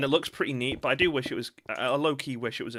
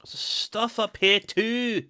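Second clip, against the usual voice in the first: high, drawn-out Scottish exclamation; Scottish accent; 8 semitones higher, 4 dB louder, much slower than usual. Real, not acted. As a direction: call it out slowly in a Scottish lilt. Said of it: "I don't know why I went Scottish".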